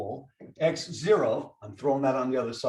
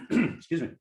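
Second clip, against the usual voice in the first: just swallowed a bug. Real, not acted. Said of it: "Swallowed a bug".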